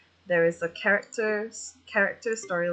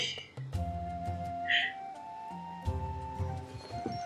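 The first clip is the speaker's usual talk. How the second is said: long breathy laugh; 8 dB quieter than usual. Real, not acted. Streamer relieved at a good day, laughing. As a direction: let out a long, high, airy laugh. Amused after telling good news.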